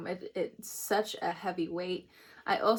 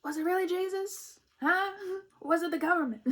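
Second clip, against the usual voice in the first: mock student questions; 9 semitones higher than usual. Mimics her students' questions.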